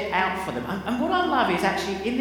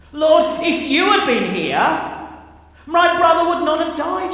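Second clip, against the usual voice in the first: louder, higher, pleading and upset; frustrated anguished outburst; 7 semitones higher, 9 dB louder than usual. Retold or imitated. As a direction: cry out at Jesus in grief and frustration. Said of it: "feel kind of the angst and the anger".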